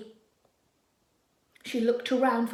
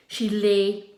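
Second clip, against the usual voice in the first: usual speaking voice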